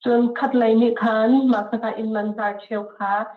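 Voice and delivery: ordinary delivery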